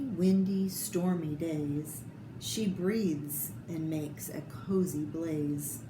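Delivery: ordinary delivery, nothing marked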